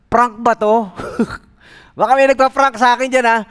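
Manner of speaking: high, loud, fast, suspicious; is someone pranking me; startled disbelief. Retold or imitated. Jogger suspects a prank.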